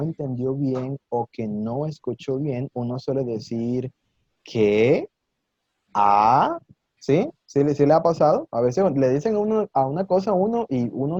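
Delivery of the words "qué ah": didn't-catch-that "¿Qué? ¿Ah?" with overdone rise; exaggerated questioning rise. Shown as a demonstration. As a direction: stretch "¿Qué?" and "¿Ah?" into rising questions. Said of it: "exagerando un poco el tono".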